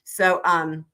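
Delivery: ordinary talk, nothing marked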